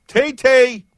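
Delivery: high, loud goofy squeal; sing-song the tag in a silly high voice; silly put-on voice. Host plugging the Twitter hashtag, silly put-on voice. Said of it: "any kind of voice you want".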